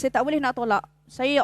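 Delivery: ordinary talk, nothing marked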